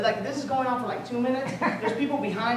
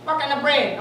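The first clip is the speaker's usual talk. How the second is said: higher, louder imitation of a Filipino accent; Filipino lady; 6 semitones higher, 8 dB louder than usual. Filipino sandwich lady asking the customer, Filipino accent. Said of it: "there was this Filipino lady there".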